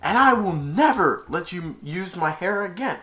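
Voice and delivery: loud, high condemnation; child's hammed-up heroine fury; defiant. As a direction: tell her off for good. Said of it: "and she said".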